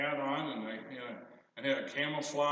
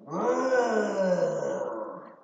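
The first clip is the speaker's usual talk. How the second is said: loud, higher mock roar; dinosaur roar; 6 semitones higher, 10 dB louder than usual. Grandpa imitating the dinosaur that scared the boy, loud mock roar. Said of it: "raise his head and go".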